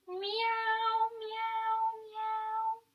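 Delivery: high, soft cat meow. Shown as a demonstration. Teacher meowing high and soft in cat pose. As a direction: meow softly like a kitten.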